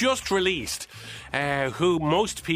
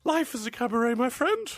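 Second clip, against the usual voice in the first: high-pitched cabaret diva impression; high mock showbiz voice; 6 semitones higher than usual. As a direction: pitch it up like a stage star.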